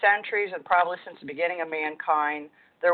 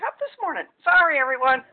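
laughing; 11 semitones higher, 4 dB louder, faster than usual. Real, not acted. Embarrassed apology on call.

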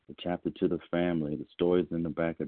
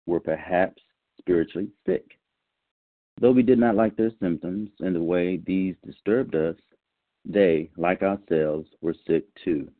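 reading aloud; 4 dB louder than usual. Acted. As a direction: read it out firmly and carefully to a group. Reads a book passage to the meeting.

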